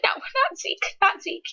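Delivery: high, breathy near-whispered plea, lightly mock-sobbed; imitating her own tearful protest, half-whispered; retold sobbing. Reviewer reenacting crying over Zeke's death, breathy and high. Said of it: "I'm sobbing".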